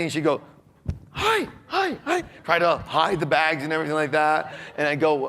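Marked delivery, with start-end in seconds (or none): 1.1-2.2 mock wife in a high squeaky falsetto; flustered wife. Wife caught hiding bags from husband, flustered falsetto. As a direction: imitate a caught-out wife, much higher.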